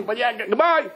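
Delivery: high, stumbling send-off; exasperated goodbye. Acted. Man walks away from the crying maiden, exasperated high goodbye. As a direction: brush them off in a high voice.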